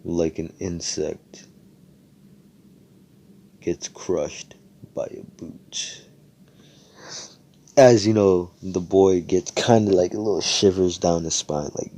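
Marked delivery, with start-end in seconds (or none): 0.0-6.1 menacing hush. Acted. Asta threatening the little kid.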